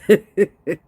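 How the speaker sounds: real snorting laughter, high and loud; helpless loud snort-laugh. Real, not acted. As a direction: burst out laughing till you snort. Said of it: "I'm snorting again".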